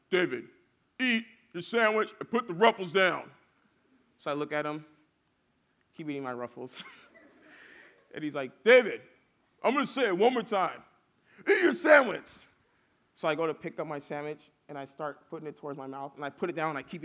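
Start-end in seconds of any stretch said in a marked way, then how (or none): 0.1-3.3 louder, higher telling-off with firm emphasis; imitated growing anger. Caretaker to child ignoring his sandwich, more mad. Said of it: "he gets a little bit more mad". 8.6-12.3 loud, high scolding building to a shout; imitated angry warning. Caretaker's last warning to stubborn child, angry. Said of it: "he gets a little bit more mad".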